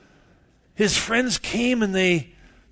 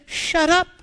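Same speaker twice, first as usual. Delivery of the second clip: high, loud retort; snapping shut up; 9 dB louder than usual. Retold or imitated. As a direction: cut him off sharply, much higher. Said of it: "I said".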